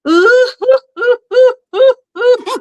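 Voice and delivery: high wailing boo-hoo sobs; imitating a child's dramatic wailing; mock boo-hoo cry. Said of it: "like a boo-hoo cry".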